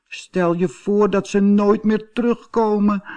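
high, plaintive little cat voice near tears; frightened kitten wailing to itself; crying kitten. A narrator's reading. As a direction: whimper it like a lost kitten. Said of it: "huilde hij".

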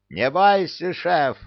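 high, loud reassuring call; cheerful shout. A narrator's reading. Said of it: "крикнул ему Люка". (бодрый выкрик)

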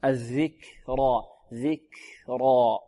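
الذكرى broken by a puff of air after k; breath on the kaf. Shown as a demonstration. Teacher isolates the kaf, slow and airy k. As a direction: softly stretch الذكرى, releasing the ك with breath. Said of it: "الكاف مهموسة".

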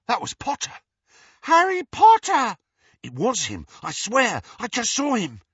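high, loud excited gasps; excited students. Acted. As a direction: blurt it out thrilled. Students excitedly spotting Harry.

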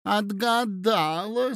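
high, drawn-out exclamation of delight; delighted cry. A narrator's reading. Lady guesses the prince's secret, delighted cry. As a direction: cry out in delight, stretching the word. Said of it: "с восторгом вскричала Фелисата Михайловна".

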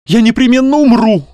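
high, loud melodramatic declaration; overwrought. A narrator's reading. Young man blocks the doctor's way, overwrought. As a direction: proclaim your doom, loud and high.

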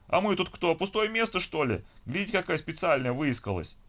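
high, loud scornful complaint; indignant retort. A narrator's reading. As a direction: fling back an offended, mocking protest. Queue member retorts sarcastically.

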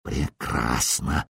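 slow, very low, hushed hiss. A narrator's reading. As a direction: draw it out under your breath. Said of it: "прошипел Кайлей". (медленно и тихо прошипеть)

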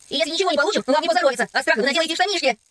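put-on cheeky boy calling back; taunting. A narrator's reading. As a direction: tease them with a mock threat. Boy shouts threats while leaving.

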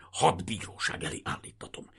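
harsh, voiceless hiss; seething, whispery menace; hissed threat. A narrator's reading.

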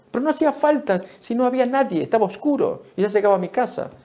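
high-pitched mock excuse; protesting driver. Lady excusing herself at traffic school, mock protesting. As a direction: imitate a driver insisting it was harmless. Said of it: "Una dama, recuerdo que decía".

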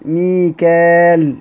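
ميكال drawn out very slowly, mi-kal with no hamza; ميكال stretched, no hamza. Shown as a demonstration. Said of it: "من غير همز".

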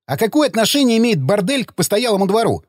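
high, baffled question; puzzled. A narrator's reading. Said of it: "недоумённо спросил Ходокири".

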